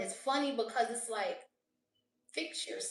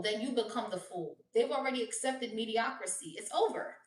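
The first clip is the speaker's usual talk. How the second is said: usual speaking voice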